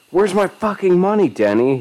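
high, loud, furious demand; screamed demand. A narrator's reading. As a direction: scream it in rage. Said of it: "he screamed again".

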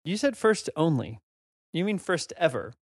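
normal talking voice

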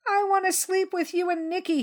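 put-on high, small child's plea; a scared kid begging to stay close; frightened little boy. Scared younger brother begs to sleep with the big boys, small high plea.

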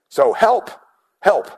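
loud, high pleading cry of help; desperate cry for help. Retold or imitated. Preacher voicing a desperate prayer, high loud pleading. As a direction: cry out to God like you're out of gas. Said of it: "where you cry out to God".

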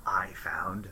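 hushed secret whisper. Acted. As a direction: lean in and whisper. Character confides secret.

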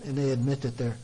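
ordinary talk, nothing marked

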